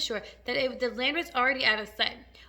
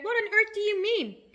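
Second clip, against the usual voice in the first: high, louder outcry; incredulous cry; 10 semitones higher, 5 dB louder than usual. A narrator's reading. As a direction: demand an explanation in disbelief. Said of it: "they cried".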